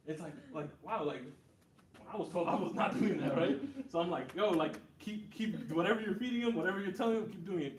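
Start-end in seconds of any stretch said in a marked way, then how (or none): 4.3-7.8 loud, high, emphatic encouragement; impressed and insistent, voice raised; enthusiastic urging. Retold or imitated. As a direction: retell an excited plea to keep it up. Urging mom on, excited by her son.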